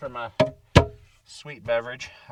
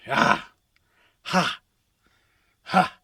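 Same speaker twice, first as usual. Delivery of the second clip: sharp loud barks of laughter; loud laughing bursts at his own rhyme; 7 semitones higher, 13 dB louder, much slower than usual. Real, not acted.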